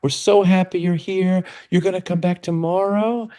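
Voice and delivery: high, syrupy sing-song coaxing; mock sugary school staff voice; sing-song teacher voice. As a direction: coo it like a teacher luring a child back. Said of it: "sing-song voice".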